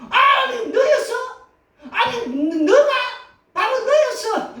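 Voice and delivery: hammed-up shocked outburst; shocked disbelief. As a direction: act out discovering the cheater. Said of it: "또 어떤 사람은".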